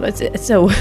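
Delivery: usual speaking voice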